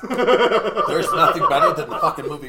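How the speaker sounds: loud laughing rave; excited. Real, not acted. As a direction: declare it with laughing passion. Passionate defense of favorite movie.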